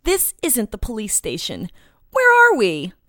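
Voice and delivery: increasingly frantic questioning; alarmed demand. Acted. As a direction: protest rising to a high, loud cry. Captive demanding answers, alarmed.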